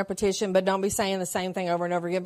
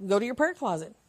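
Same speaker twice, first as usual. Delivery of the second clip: ordinary talk, nothing marked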